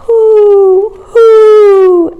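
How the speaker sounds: loud, high, long hoots; owl hoot. A narrator's reading. Reader voicing the owl asking who built the web, high hooting. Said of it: "asked the owl".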